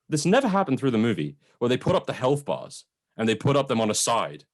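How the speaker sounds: ordinary talk, nothing marked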